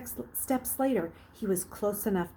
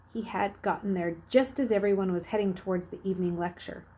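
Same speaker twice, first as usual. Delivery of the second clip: ordinary delivery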